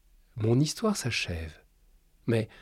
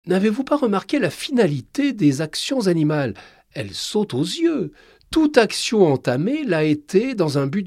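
higher, louder, earnest expert voice; learned doctor on TV; 7 semitones higher, 6 dB louder than usual. Acted. Doctor Chaton explains the animals' wisdom on TV, higher and louder. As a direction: lecture the audience with conviction.

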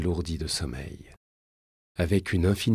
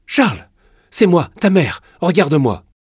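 high, loud commanding plea; urgent shout; 10 semitones higher, 10 dB louder than usual. Acted. Mother bringing her panicking son round, urgent shout. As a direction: call them sharply back to reality.

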